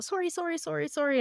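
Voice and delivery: high, soft string of apologies; imitated sheepish, embarrassed sorry; Canadian over-apologizing. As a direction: apologize meekly on repeat as you retreat. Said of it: "My Canadianness took over".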